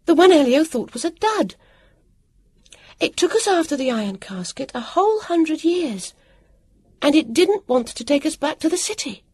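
very high, excited cry; excited burst, loudest at the start; relieved outburst. A narrator's reading. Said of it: "cried out in a burst of relief".

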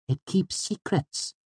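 high, soft, slow little voice; Auri. A narrator's reading. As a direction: confide it slowly and softly, pitched high. Auri explains the ring.

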